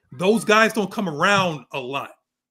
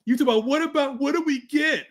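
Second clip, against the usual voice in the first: higher, hyped wrestler taunt; John Cena promo; 5 semitones higher, 3 dB louder than usual. Pretend. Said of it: "the John Cena promo".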